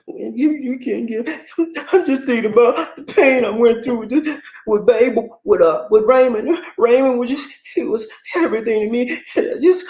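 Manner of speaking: high, half-laughing fake tears; mock crying girlfriend. Girlfriend telling her man about Raymond, in mock tears. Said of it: "she starts crying".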